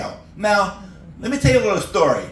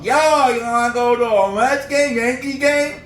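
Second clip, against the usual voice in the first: put-on higher Brooklyn Bronx accent; 6 semitones higher than usual. Comic imitating downstate New Yorkers in a Bronx accent. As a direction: Brooklyn Bronx street talk, pitched up. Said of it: "that Brooklyn, that Bronx talk".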